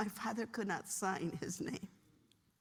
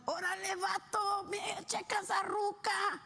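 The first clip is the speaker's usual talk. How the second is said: high-pitched street-homie Spanish; homie calling out; 9 semitones higher than usual. Retold or imitated. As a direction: play an excited homie tipping off his buddy. Acted homie calling to friend.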